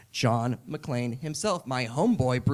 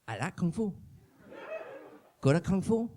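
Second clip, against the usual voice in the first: Jet Li voice; 3 semitones higher, 4 dB quieter than usual. Retold or imitated.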